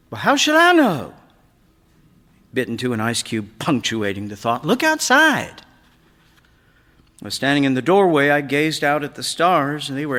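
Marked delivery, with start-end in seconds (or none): 0.1-1.1 put-on much higher, louder snappy teenage voice; teen son snapping at his father; irritated teen. A narrator's reading. Annoyed teen son answering father. 4.6-5.6 put-on much higher, louder snappy teenage voice; teen son snapping at his father; irritated teen. A narrator's reading.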